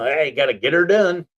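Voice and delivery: hammed-up Southern yell of the catchphrase; Larry catchphrase. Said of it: "I came up with this, like, catchphrase".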